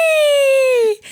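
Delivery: long, loud, very high squeal; gleeful "whee". Acted. Everyone going down the inflatable slide, squealing whee. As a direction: shriek it out like riding a slide. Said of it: "And you're all".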